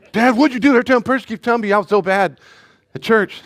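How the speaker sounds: mock kid whine. Son complaining to dad about church, high whine.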